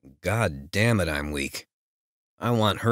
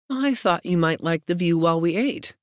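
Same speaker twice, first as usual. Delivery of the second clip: female voice; 12 semitones higher, 4 dB louder than usual. Acted. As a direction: voice the heroine, playful and high. Heroine speaks, teasing.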